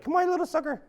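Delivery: high, laughing coax; Irish locals tempting E.T., high laughing coax; mock Irish coaxing. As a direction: lure him in, high and laughing. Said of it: "everyone in Ireland be like".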